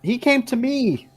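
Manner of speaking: slow, high-pitched defensive whine; high-pitched protest. Acted. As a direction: protest your innocence in a high voice. Bitten character defends himself.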